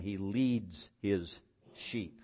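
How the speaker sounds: slow, with leads drawn out and weighted; stress on leads. Real, not acted. Preacher to his congregation, slowly weighting leads. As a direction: lean hard on leads and let it linger. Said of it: "I emphasize the word lead".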